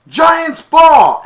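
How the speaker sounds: high, very loud call; referee shout. Retold or imitated. Referee announcing the ball change, shouted. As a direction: bellow the ruling. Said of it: "they went".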